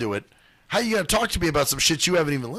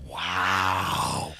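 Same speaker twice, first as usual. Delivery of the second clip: low, hushed, drawn-out mock awe; mock amazement; 9 semitones lower, 4 dB quieter than usual. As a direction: stretch out "wow" softly, imitating awed listeners. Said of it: "even more fucking remarkable to them".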